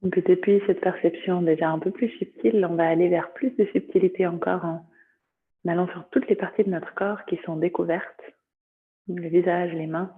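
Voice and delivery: calm yoga nidra guidance, long pauses between phrases; guided meditation. Acted. Teacher guiding students through body sensations, meditative voice.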